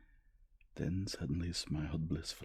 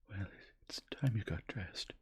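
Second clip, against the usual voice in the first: recovering herself; 5 semitones higher than usual. A narrator's reading. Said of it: "recovering herself".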